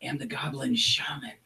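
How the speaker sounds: a sly shaman sharing a secret grudge; goblin shaman whisper. Acted. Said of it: "And she says".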